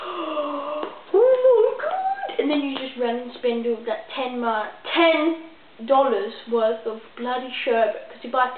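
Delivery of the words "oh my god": high, slow, amazed oh my God; drawn-out delighted gasp; gasping excitement. Retold or imitated. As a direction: kid spotting the sherbet, thrilled. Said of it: "and you're like, gasps".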